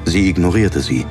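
ordinary delivery, nothing marked

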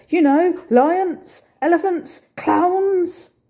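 high, loud shout across the street. Acted. Bertie shouting at the cafe owner, loud and high. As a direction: shout it so a foreigner gets it. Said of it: "he shouted in English instead".

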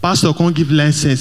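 ordinary talk

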